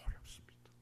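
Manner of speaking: whispered answer. Real, not acted.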